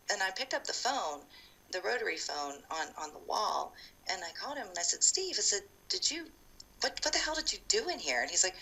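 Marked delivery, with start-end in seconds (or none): 5.0-8.2 high-pitched, incredulous demand; retold confrontation. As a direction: retell a phone confrontation, pitched well up. Confronts friend on the phone.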